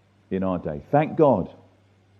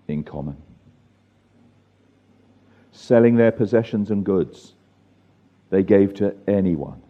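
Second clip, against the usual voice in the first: deliberate, measured Bible reading in separated phrases; slow reading; 4 dB louder than usual. Real, not acted. Leader reading scripture aloud before closing prayer, slowly. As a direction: read it slowly, letting each phrase stand. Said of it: "let me read it slowly".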